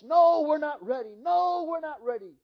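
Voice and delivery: high, loud panicked cry of no; reliving the terror, cry out in alarm; terrified scream. Retold or imitated. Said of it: "I yelled and I screamed at the top of my voice".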